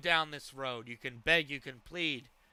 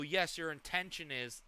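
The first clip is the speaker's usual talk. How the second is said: normal talking voice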